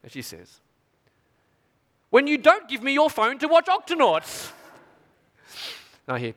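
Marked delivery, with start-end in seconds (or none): 2.1-4.5 put-on high, slow little girl voice; child's voice. Daughter telling her dad why she gets angry, high and slow. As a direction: innocent toddler stating her grievance. Toddler's answer.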